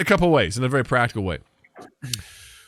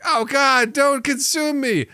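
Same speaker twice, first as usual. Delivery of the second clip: 1 mock pleading cereal; 11 semitones higher, slower than usual. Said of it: "would it be like".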